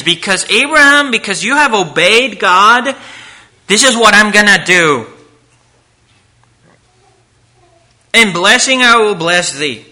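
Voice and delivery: loud, fervent preaching; preaching at full force. Acted. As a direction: proclaim it from the pulpit. Preaching God's promise.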